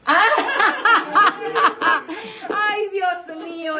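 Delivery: high helpless laughter, slowing into ay Dios mío; real delighted laughing fit. Real, not acted. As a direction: laugh until it almost turns to tears. Overwhelmed by love story.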